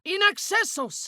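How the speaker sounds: very high incantation; spell cry. Acted. Casting in battle, high cry. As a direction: hurl the spell word.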